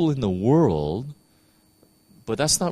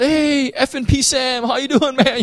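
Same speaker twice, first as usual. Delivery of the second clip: crude greeting; 4 dB louder than usual. Retold or imitated. Church member greeting the pastor, hammed-up high bro voice. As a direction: ham up a cursing church member.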